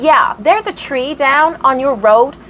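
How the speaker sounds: drawn-out, badly faked American accent; attempted American accent. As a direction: imitate the neighbour in a rough American accent. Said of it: "Can't do an American accent, oh, bear with".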